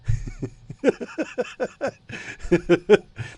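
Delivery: high bursting laugh at himself. Real, not acted. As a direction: laugh off the bad accent. Laughing at own accent.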